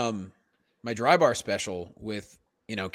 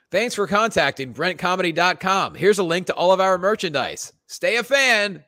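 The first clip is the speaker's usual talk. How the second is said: canned reply voice; 6 semitones higher, 5 dB louder than usual. Pretend. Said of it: "email saying".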